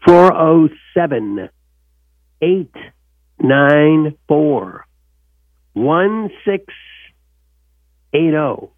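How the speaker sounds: slow digits with long gaps between groups. Shown as a demonstration. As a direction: spell the number out unhurriedly, pausing after each piece. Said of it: "I'll say it slow, slow".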